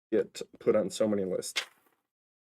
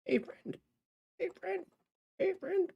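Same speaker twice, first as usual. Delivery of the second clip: very high squeaky hey friend; silly high greeting; 5 dB quieter than usual. Pretend. Greeting viewers in a squeaky cartoon voice.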